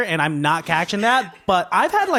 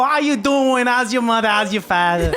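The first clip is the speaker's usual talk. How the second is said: put-on New York accent; 4 semitones higher than usual. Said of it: "You look like a New Yorker right now".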